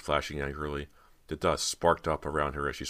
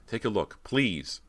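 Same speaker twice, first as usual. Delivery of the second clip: higher, urgent plea; pleading; 7 semitones higher, slower than usual. A narrator's reading. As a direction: implore her to look. Cody pleads with her sister.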